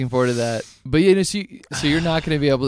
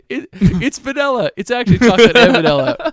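higher, laughing through the words; 8 semitones higher, 6 dB louder than usual. Real, not acted. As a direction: giggle through the line. Laughing while repeating joke.

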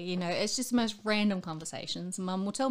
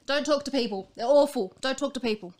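loud mock orders; 7 semitones higher, 8 dB louder than usual. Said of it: "I'm like".

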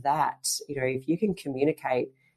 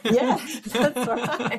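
laughing through it, higher and slow; 9 semitones higher, 4 dB louder, much slower than usual. Real, not acted. Laughing along with the host's joke, higher and drawn out. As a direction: let the laugh carry the words.

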